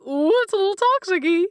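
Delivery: high, bright ooh of playful guilt; mock-sheepish admission. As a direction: squeal it out like a caught teenager. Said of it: "I'm like".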